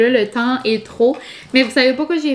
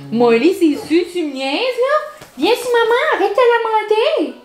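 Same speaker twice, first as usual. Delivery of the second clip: doggy mom voice; 9 semitones higher than usual. Real, not acted. Telling her dog to stop climbing, high baby talk. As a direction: scold the puppy sweetly, way up high.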